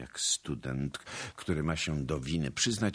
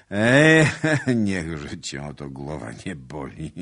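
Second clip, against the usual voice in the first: dismissive; 10 dB louder than usual. A narrator's reading. Suitor shrugging off his lady's warning, laughing dismissal. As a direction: wave it away with a chuckle.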